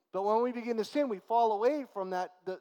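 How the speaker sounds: preacher building to full force on the law; fervent preaching. Acted. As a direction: drive the point home to the congregation.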